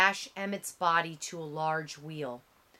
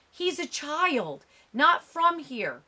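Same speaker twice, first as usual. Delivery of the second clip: put-on higher, louder desperate plea for the boy; pleading; 8 semitones higher, 5 dB louder than usual. A narrator's reading. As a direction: beg the men to spare him. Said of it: "his uncle pleads".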